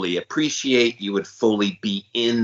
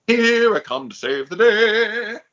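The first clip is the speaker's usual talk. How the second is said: high, slow heroic cartoon cry; Mighty Mouse; 11 semitones higher, 4 dB louder than usual. Friend imitates Mighty Mouse flying to the rescue, heroic cartoon voice. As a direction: belt it like a cartoon hero swooping in. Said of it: "Like Mighty Mouse".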